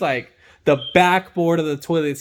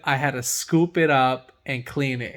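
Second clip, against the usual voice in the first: normal talking voice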